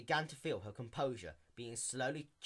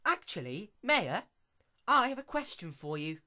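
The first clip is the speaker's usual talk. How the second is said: raised, sly scheming tone; 8 semitones higher, 7 dB louder than usual. Acted. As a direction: sound like you have a plan. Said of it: "A sly grin crept over".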